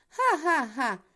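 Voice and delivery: malicious mocking laugh; evil devil laugh. A narrator's reading. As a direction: cackle with spite, like a devil. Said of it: "râse diavolul cu răutate". (râs răutăcios de drac)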